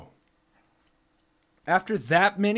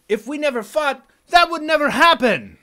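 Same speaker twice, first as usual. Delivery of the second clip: raised, high, loud insistence; heated protest; 11 semitones higher, 10 dB louder than usual. Real, not acted. Speaker blames the endless fighting for snapping, heated shouting. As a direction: burst out loudly in self-justification.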